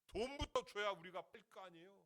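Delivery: high, loud worker's protest; indignant demand. Retold or imitated. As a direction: push back loudly as an unpaid worker. Workers demand pay before digging.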